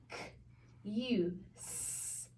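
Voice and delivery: "K, U, S" as separate sounds with gaps; sounded out. Shown as a demonstration. Teacher sounds out a syllable for students, sound by sound. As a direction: isolate "K", "U", then a voiceless "S". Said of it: "put those both together to read it".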